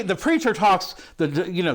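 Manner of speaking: normal talking voice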